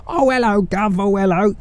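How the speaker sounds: high mock posh-Cockney greeting; imitating how outsiders think Cockneys talk. Cockney detective mocking what foreigners say to him, mock Cockney. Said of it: "that's what Cockneys sound like".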